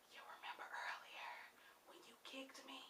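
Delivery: eerie whisper in the ear; creepy spider whisper. Pretend. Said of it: "in my ear whispering".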